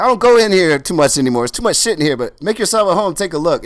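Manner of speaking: put-on high, loud proud old man. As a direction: announce your famous guests with swagger. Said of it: "He's like".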